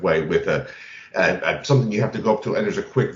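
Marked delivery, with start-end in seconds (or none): none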